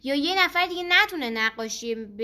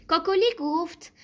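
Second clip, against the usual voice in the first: ordinary delivery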